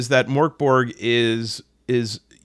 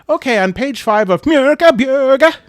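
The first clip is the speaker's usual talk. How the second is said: much higher and louder hammed-up Swedish; fake Swedish accent; 13 semitones higher, 7 dB louder than usual. Host mocking himself switching into a Swedish accent. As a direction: put on an overdone Swedish accent mid-review. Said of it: "switch my accent".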